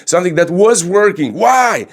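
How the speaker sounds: high, pressing why-questions; baffled Harry. Retold or imitated. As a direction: demand an answer, baffled and pushing. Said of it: "Then Harry comes in".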